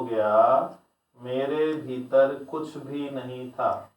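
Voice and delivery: slow, measured teaching narration; solemn discourse narration. A narrator's reading. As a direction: tell it as scripture, each phrase set apart by a pause. Reads a Buddha story aloud. (धीमे, ठहर-ठहर कर प्रवचन शैली में कथा वाचन)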